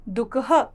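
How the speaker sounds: दुखः with a breathy ह at the end; answer demo. Shown as a demonstration. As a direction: answer your own question clearly. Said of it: "क्या बोलेंगे?". (दुखः, साफ़ उच्चारण)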